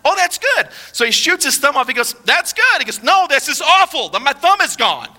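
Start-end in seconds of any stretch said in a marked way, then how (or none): 0.0-0.7 high-pitched cheery optimist; delighted, lilting high voice; super optimistic. Acted. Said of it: "just super optimistic". 2.2-2.8 high-pitched cheery optimist; delighted, lilting high voice; super optimistic. Acted. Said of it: "just super optimistic". 3.0-5.1 mock-upset king, high; acted indignant outburst; mad. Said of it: "he gets mad at his friend".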